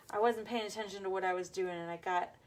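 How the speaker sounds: usual speaking voice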